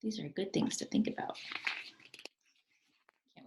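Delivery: whisper. Real, not acted. Teacher whispering an aside to the class. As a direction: lean in and whisper it.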